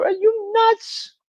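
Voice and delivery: high, slow incredulous squeak; mock disbelief. Host mocking hotheads on YouTube, mock disbelief. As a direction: stretch it out in mock shock.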